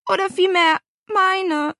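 high, upset admission; sniffling child. A narrator's reading. Niece taking all the blame, sniffling. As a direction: own up in a small tearful way. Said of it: "Paula schniefte".

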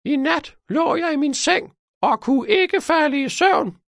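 put-on very high, piercing old-woman voice; shrill old cleaning lady. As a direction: recount the sleepless night shrilly, stretching out the chores.

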